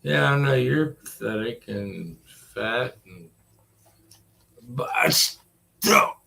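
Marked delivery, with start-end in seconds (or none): none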